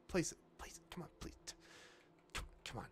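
whispered pleading. Real, not acted. Straining to place item.

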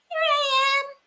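high, hollow wind spirit answering; north wind voice. Acted. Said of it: "said the north wind".

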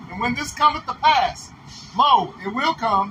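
slow, measured scripture reading. Shown as a demonstration. Reader redoing Ezekiel verse after being told, slow and deliberate. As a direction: read the verse slowly, stretching each phrase. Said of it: "You gotta read that a little slower".

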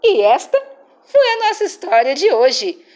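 ordinary delivery

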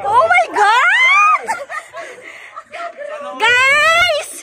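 loud, slow laughing shout. Real, not acted. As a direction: yell it out while laughing. Shouting on the ride.

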